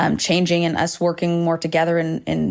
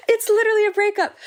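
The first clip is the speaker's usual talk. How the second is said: much higher, fast, half-crying; laughing through tears; 14 semitones higher, faster than usual. Real, not acted. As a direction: blurt it out while half-sobbing.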